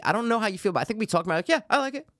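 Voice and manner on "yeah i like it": high-pitched mock casual approval; imitated reaction. Imagining the co-host's reaction, high mock voice. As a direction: put on a high little voice for the quote.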